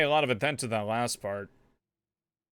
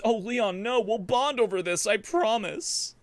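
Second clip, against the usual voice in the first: higher, gushing reassurance, played up; playful higher-pitched sympathy; mock consoling; 7 semitones higher than usual. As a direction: coo it at him, drawing out the promise. Playfully consoles Leon in character.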